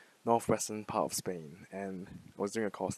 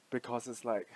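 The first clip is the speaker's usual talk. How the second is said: ordinary talk, nothing marked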